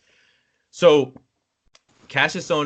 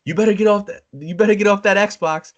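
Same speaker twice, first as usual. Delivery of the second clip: high, loud parental order; imitated scolding mom; 10 semitones higher, 5 dB louder than usual. Mom ordering kid off the Xbox, mock scolding. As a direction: call it out like a mom from another room. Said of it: "we hear from the other room".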